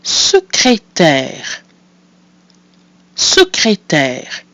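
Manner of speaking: Secrétaire said slowly, syllable by syllable; careful French. Shown as a demonstration. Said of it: "I'll say the word twice, leaving you time to repeat".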